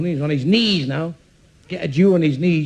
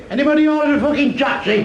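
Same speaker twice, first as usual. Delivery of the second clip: shouted call; 10 semitones higher than usual. Acted. Saint Peter shouting inside.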